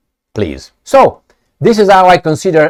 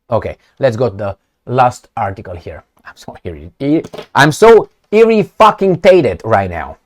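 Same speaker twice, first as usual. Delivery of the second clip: ordinary delivery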